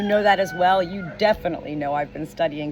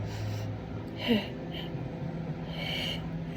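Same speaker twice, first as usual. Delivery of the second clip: long unvoiced deep sigh; deep breath; 3 semitones higher, 11 dB quieter than usual. Real, not acted. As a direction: breathe out slowly and fully. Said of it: "take a deep breath without words".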